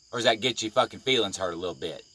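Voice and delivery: ordinary delivery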